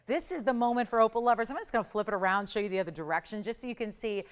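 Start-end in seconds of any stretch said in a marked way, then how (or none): none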